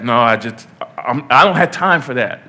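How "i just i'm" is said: ordinary talk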